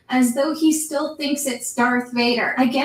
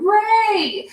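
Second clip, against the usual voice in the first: high, mock call across the desert; retold yell; 9 semitones higher than usual. Finn calling to Rey across the desert, mock yell. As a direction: call her name like a half-played shout. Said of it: "she hears Finn yelling".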